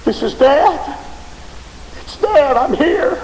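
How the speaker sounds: high, loud, urgent call; desperate calling. Acted. Father calling to his son in hell, desperate cry. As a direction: call out so your child knows you.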